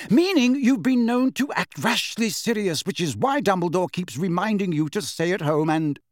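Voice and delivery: high, insistent reproach; heated accusation. A narrator's reading. Quarrel, Molly accuses Sirius.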